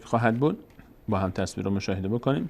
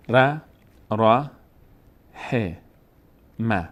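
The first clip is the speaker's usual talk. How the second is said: one by one; 2 semitones higher, 5 dB louder, much slower than usual. Shown as a demonstration. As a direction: separate syllables with clear pauses between.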